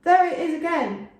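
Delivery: a high, loud alarmed yell; a man yelling that the bird is back. A narrator's reading. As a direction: cry out the alarm to the others. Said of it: "yelled out".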